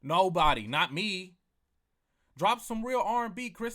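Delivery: blurting out a loud no; loud rant. Real, not acted. Ranting podcaster shouting his answer. Ranting about [name]'s long album.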